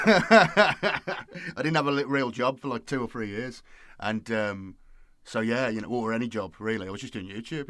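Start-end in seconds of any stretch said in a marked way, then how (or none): 0.0-2.7 talking through real laughter; loud, higher words breaking out of a laugh. Real, not acted. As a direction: let the laugh spill into the words. Laughs at own lockdown life.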